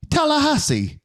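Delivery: very high mock British accent. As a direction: say it as a hammed-up Brit. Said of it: "like, this British accent".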